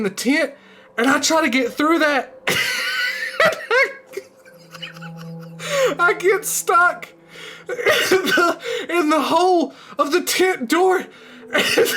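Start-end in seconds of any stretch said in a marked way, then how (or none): 2.5-3.9 high-pitched burst of real laughter; uncontrolled laughing fit; helpless laugh. Real, not acted. Storyteller cracks up at own tale. 6.0-11.9 high, drawn-out words through giggles; breaking up with laughter; losing it laughing. Real, not acted. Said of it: "I'm losing it".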